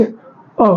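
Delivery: अ held as a clear open vowel from the throat; drawn-out short a. Shown as a demonstration. Teacher showing students the throat vowel अ. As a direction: sound out अ slowly for the class. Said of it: "एक बार फिर से".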